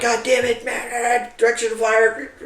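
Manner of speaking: high, urgent shout of orders; urgent high shout to his squad. Retold or imitated. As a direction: bark the order over gunfire. Shouting orders under fire.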